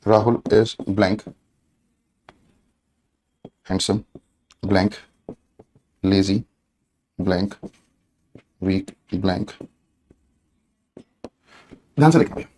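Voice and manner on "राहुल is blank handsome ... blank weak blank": low, soft words with long gaps; unhurried board-writing voice, pitched down; slow dictation. Real, not acted. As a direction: murmur it word by word as you write.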